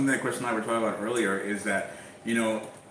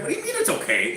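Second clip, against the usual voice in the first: much higher, indignant retold question; outraged disbelief; 3 dB louder, faster than usual. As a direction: protest on her behalf, pitch shooting up. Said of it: "I'm getting upset for her".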